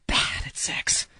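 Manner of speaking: whispered aside. Real, not acted. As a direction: drop to a whisper for the jab. Aside under the breath.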